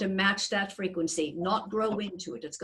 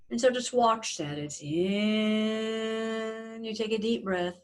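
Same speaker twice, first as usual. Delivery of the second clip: guided meditation. Acted. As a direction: gently guide their breathing. Leads a breathing meditation.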